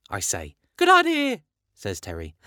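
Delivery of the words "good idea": excited high voice. A narrator's reading. Terry voiced in story.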